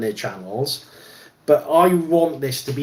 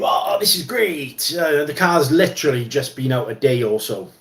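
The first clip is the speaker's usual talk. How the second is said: mock American reviewer. Mocking reviewers who gush over new cars, American accent. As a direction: ham up an overexcited American car reviewer. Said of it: "I'm using an American voice".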